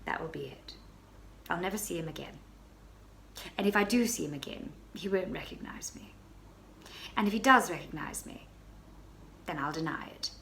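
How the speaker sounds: low, hushed confiding tone; hushed aside. Acted. Baroness confiding her plan in a hush. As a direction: murmur it like a secret to a friend.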